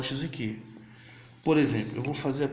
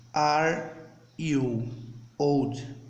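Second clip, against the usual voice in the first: word-by-word demo English; much slower than usual. Shown as a demonstration. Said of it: "você fala".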